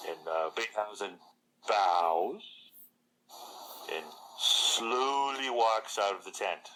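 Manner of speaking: ordinary talk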